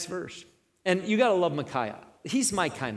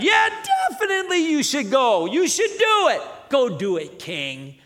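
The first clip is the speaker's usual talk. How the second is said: loud, high mocking agreement; sarcastic; 9 semitones higher, 6 dB louder than usual. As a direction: lay the sarcasm on thick. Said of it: "one of those sarcastic prophets".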